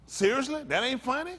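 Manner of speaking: normal talking voice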